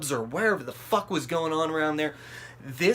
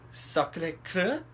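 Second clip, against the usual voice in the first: slow, higher, rising guess; unsure question; 6 semitones higher, much slower than usual. Retold or imitated. Asking the French driver for a place, unsure question. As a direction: try the foreign name out tentatively.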